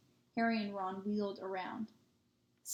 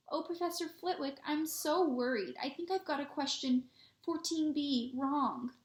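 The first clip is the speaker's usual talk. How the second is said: mock high voice; 6 semitones higher than usual. Boy teases his friend by mimicking her in a higher voice. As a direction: squeak it out like a fretting pupil. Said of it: "put on a high voice".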